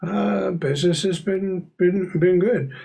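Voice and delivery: lightly voiced neighbor making small talk; Mister Corbett. Neighbor chatting with young Alice at the door, lightly voiced, hesitant.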